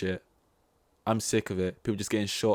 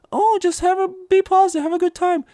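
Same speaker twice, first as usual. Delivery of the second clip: much higher, louder chirpy cartoon sponge voice; squeaky overjoyed cartoon cheer; SpongeBob impression; 5 dB louder than usual. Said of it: "ambushed by SpongeBob".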